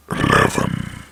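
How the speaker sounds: drawn-out, growling horror announcer; spooky countdown voice. Acted. Narrator announces the next creepy story, spooky countdown voice. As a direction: boom the number out long and eerie.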